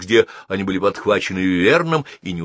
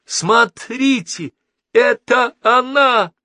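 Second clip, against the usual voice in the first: very high, drawn-out scream of alarm; horrified cry; 5 dB louder, slower than usual. A narrator's reading. Passenger recognizing the attacker, horrified cry. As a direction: stretch each word high in terror. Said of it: "вдруг в ужасе закричала".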